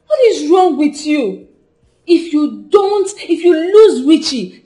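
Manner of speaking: slow down and hammer the warning; exasperated scolding. Acted. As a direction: scold a friend with heavy emphasis. Scolding a friend who drove him away.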